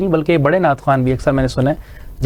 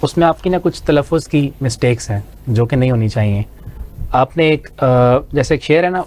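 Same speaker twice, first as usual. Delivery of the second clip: usual speaking voice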